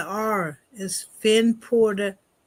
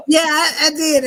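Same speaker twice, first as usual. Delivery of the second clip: laughing; 6 semitones higher, 7 dB louder than usual. Real, not acted. Laughing agreement after joke.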